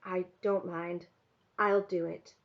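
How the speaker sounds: slow, quiet, resigned acceptance; said slowly. A narrator's reading. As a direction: weigh each word, a little softly. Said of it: "I said slowly".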